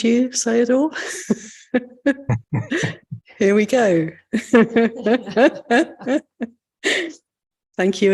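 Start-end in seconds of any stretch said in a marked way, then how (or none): 1.7-3.2 high, light chuckle; light laugh. Real, not acted. Host searching for the guest on screen, light high laugh. 4.3-6.5 high, bubbling chuckle; real chuckle. Real, not acted. Host finds the guest at last, real chuckling laugh.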